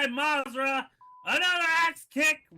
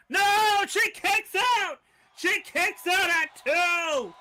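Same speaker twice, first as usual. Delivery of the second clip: excited shout; 5 semitones higher, 5 dB louder, slower than usual. Real, not acted. Pin broken at two.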